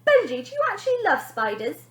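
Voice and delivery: put-on high, loud girl voice; suspicious big sister. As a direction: probe him suspiciously.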